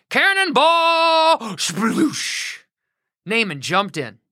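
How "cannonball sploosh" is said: high, drawn-out gleeful shout; loud slow cannonball cry then splash sound; cannonball yell. Acted. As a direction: belt it mid-jump. Naaman jumps into river.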